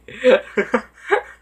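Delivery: loud, high burst of laughter; self-mocking laugh. Real, not acted. Speaker laughing after forgetting what to say, self-mocking laugh. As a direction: laugh out loud at your own slip.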